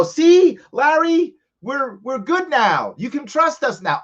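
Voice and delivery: hint of an official saying all is fixed; mock reassuring government. Government assures the viewer Larry it is trustworthy now, mock reassuring. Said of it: "want them to be better and go".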